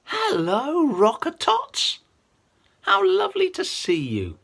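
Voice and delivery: excited greeting. Acted. Greeting toddlers with puppet energy.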